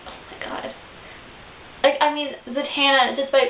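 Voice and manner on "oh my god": whispered oh my god; hushed stunned whisper; awed whisper. Retold or imitated. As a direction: breathe it out in shock. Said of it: "was like".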